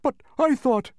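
high, loud, taken-aback objection; astonished protest. A narrator's reading. Humbug objecting to census taker, astonished. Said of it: "protested the astonished bug".

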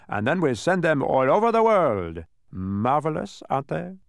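loud, high, swelling boast; proud showing-off. A narrator's reading. Guide boasting of numbers sent worldwide, loud pride. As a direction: show them off with relish.

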